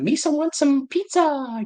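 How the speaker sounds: very high, slow Jar Jar Binks voice; Jar Jar impression. In the lunch line, classmate talking like Jar Jar, high and slow. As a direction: squeak it out slowly like Jar Jar. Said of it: "he'd be like".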